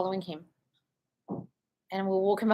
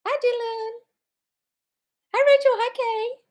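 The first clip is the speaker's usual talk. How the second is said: very high, chirpy hellos; singsong greeting; 5 dB louder than usual. Real, not acted. Host greeting viewers joining live, singsong. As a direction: sing out each hello up high.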